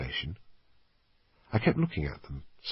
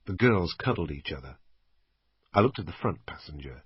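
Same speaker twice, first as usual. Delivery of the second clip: usual speaking voice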